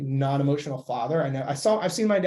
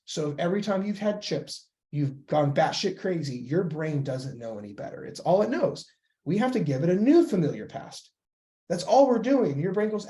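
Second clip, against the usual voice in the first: ordinary talk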